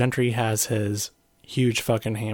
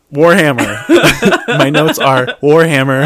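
laughing; 4 semitones higher, 14 dB louder, slower than usual. Real, not acted. Laughing over own notes.

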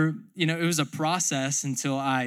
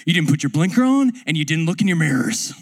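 loud, changed coach voice; scolding coach; 2 semitones higher, 7 dB louder than usual. Retold or imitated. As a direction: scold the student's mistake. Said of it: "He's like".

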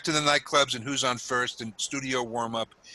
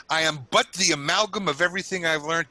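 usual speaking voice

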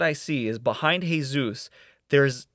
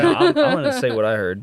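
laughing; 10 semitones higher, 3 dB louder than usual. Real, not acted. Laughing while announcing gossip.

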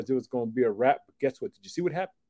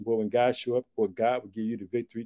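ordinary delivery

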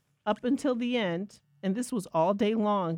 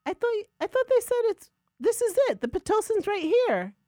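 husband's voice; 13 semitones higher, 4 dB louder than usual. Retold or imitated. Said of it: "And he said".

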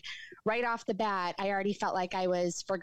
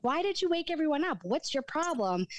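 sharp high demand at her brother; retelling an angry outburst, pitch jumping up; mad; 9 semitones higher than usual. Retold or imitated. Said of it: "so I was so mad".

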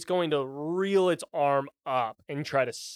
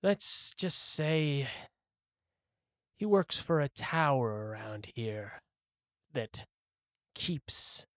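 softer, measured words with long pauses; sly golem; 4 semitones lower, 7 dB quieter than usual. Acted. Golem hinting to the player about a tower, guarded.

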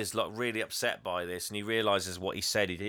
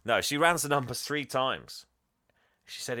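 normal talking voice

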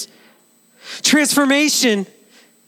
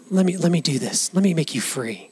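low, soft and gentle; quiet, reassuring angel voice; tender angel; 6 semitones lower, 5 dB quieter than usual. Acted. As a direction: coax him softly into freedom. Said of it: "the angel says".

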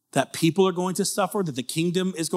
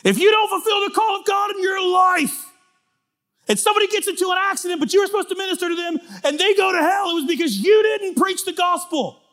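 much higher, louder hellfire shout; mocking fiery preacher; 14 semitones higher, 5 dB louder than usual. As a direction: ham up a screaming preacher. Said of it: "heard preachers say".

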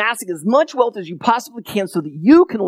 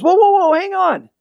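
high, hammed-up startled "whoa" to stop him; startled Peter; 11 semitones higher than usual. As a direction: play Peter jumping to block the gate. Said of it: "and Peter goes".

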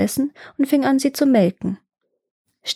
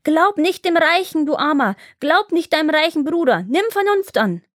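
high-pitched scolding cries, climbing higher; excitedly shrill out a warning at raised pitch; crying out; 7 semitones higher than usual. A narrator's reading. Sticks berate poor Iwan while beating him, crying out.

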